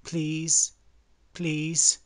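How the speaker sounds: high, loud repeated pleas; hammed-up begging; pleading beggar. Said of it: "Stop begging".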